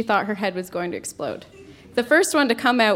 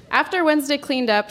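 usual speaking voice

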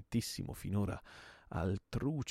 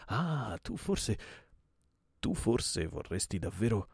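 raised, eager dawning realization; gleeful hope; 3 semitones higher, 4 dB louder than usual. Acted. Host guessing what his visitor intends, gleeful. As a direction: let hopeful delight break in, hesitating. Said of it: "con gli occhi brillanti di gioia".

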